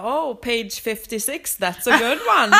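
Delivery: slow teasing drawl breaking into a loud laugh; mock the reader slowly, then crack up; hammed-up teasing animator, laughing loud. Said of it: "Och han bara".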